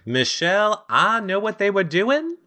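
high-pitched imitation of a suspicious onlooker; Sydney. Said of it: "and she was like".

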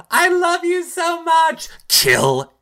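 high, loud, sugary voice turning to an order; mock sweet then sinister. Imitating Missy ordering Elizabeth to kill Elaine. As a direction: gush then command the kill. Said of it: "she's like".

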